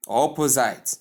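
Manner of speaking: "opposite" ending like "site", o-po-zite; mispronounced opposite. Shown as a demonstration. Teacher showing learners a wrong opposite, mispronounced to end in "zite". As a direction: rhyme the end of "opposite" with "kite". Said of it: "but simply opposite".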